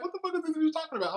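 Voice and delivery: fast, high, hushed incredulity; thinking it in shocked disbelief; inner disbelief. Retold or imitated. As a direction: rattle it off in a stunned head-voice. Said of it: "I'm thinking in my head".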